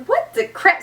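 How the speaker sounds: high-pitched mock disbelief; playful, incredulous outburst; shocked reaction. Recapping her own shocked reaction.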